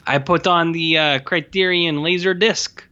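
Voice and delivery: lightly mocking Guillermo del Toro in a French Canadian accent; a film director quoted in a hammy French Canadian accent. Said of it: "Why are you doing a Denis Villeneuve voice".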